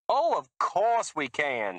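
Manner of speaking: high, slow, loud fake Southern reassurance; lying cry. A narrator's reading. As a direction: cry it out to hide a lie. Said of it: "she cried".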